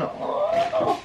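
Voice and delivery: very high, breathy oh of delight; a single very high swooning oh. Real, not acted. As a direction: swoon at the trousers. Seeing the satin trousers.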